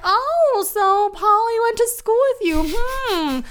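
very high, fake-casual mock interrogation; passive-aggressive mother. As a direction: imitate a prying mom, sing-song high then easing down.

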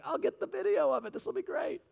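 high, soft singsong enthusiasm; mock eagerness. Husband eagerly offering to film his wife's float, retold high and chirpy.

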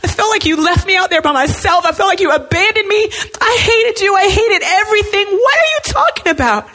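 high, pleading acted rant; acted anguished complaint, high and insistent; distraught outburst. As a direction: pour out hurt and anger, pitch climbing. Confessing anger to God, acted out.

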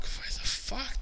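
retold shock, high and hushed. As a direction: act out catching yourself, pitch jumping up softly. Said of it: "I'd let it go in shock".

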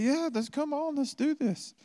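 very high sing-song encouraging voice; cheery parent to child. Retold or imitated. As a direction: coax a toddler brightly. Parent voicing to kid.

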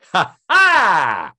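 high, loud "ha-haa" with a long falling tail; big drawn-out laugh that slides down; loud mock laugh. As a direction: burst out with a theatrical ha-ha. Loud exclamation at the sign gag.

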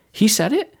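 very high, incredulous rising question; retold shock. Best surgeon hears another can do it, shocked. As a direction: imitate stunned disbelief, pitch leaping up. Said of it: "he was so totally shocked".

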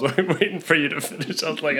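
breathy laugh through the words; giggling while talking. Real, not acted. Laughing after a coughing fit.

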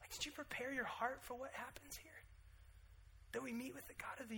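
whispered challenge. Real, not acted. Preacher whispers a pointed question to the congregation.

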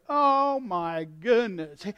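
drawn-out groan rising to a loud, high complaint; slow, indignant grumbling that climbs; crotchety exasperation. Acted. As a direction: play an exasperated naysayer. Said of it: "and old, you know, crotchety people".

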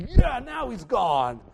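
mock smug relish; smug satisfaction. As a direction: savour it like the villain got what he deserved. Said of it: "and you're like".

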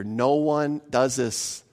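ordinary talk

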